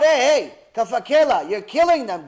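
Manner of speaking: loud, high demanding shout; screaming out. Acted. Gehenom shouting at Kaf Akela, loud demanding cry. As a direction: Gehenom bellows for its turn. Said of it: "Gehenom is screaming out".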